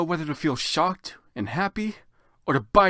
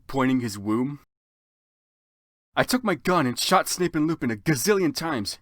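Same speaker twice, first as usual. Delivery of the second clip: ordinary delivery, nothing marked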